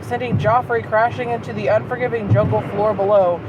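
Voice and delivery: normal talking voice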